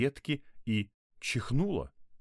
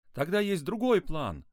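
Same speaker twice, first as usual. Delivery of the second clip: high-pitched, excited proposal; eager boy; 10 semitones higher, 4 dB louder than usual. A narrator's reading. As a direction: burst out with a bright idea. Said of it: "Глаза Максима загорелись".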